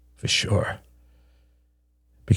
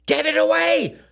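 shrill panicked scream, much higher. A narrator's reading. Beatrice, allergic, screaming at the wasp. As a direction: scream it in terror. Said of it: "screamed Beatrice".

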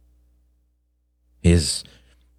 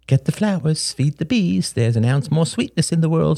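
a higher, sing-song mother's saying; imitating mother; 11 semitones higher than usual. Beatrice quoting Shane's mother's flower saying, mock voice. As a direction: lightly quote mom's gentle motto. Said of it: "she always says".

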